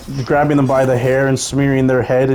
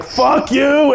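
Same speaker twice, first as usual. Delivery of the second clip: furious shout; 13 semitones higher than usual. Retold or imitated. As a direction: explode at the bum. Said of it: "And he just is like".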